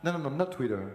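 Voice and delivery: ordinary delivery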